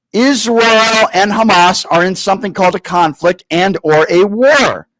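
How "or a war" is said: slow, condescending. Shown as a demonstration. Host to caller, slowly and condescendingly. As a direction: drag out each word slowly. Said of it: "Let me try to go slow for you".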